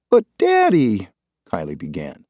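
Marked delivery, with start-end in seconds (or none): 0.1-1.1 put-on high, slow child's protest; a little girl trying to object; pleading little girl. A narrator's reading. Daughter interrupting her father, high slow plea.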